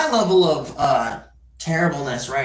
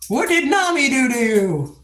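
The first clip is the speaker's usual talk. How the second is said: mock viewer voice; 9 semitones higher than usual.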